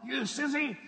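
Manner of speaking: high, loud mock insult shouted with a laugh; playful loud jeer; teasing yell. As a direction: taunt an old friend from far off. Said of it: "I yelled out as loud as I could".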